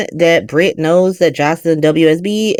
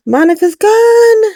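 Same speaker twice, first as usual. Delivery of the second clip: breathless gasp, then higher; gasp of shock; 9 semitones higher, slower than usual. Real, not acted. Fan reacting to a character's death, gasping. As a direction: gasp and say it slowly. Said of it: "grief over a character".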